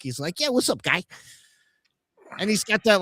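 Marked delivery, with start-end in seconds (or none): 0.4-1.0 put-on high-pitched Yonkers accent; imitated New York street accent. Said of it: "sounds like he's from Yonkers or, like, New York".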